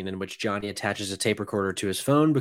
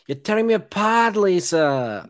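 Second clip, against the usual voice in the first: higher, louder hammed-up yell; mock Tommy yell; 9 semitones higher, 5 dB louder than usual. Quoting Tommy's trademark line, mock yell. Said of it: "that, that he yells".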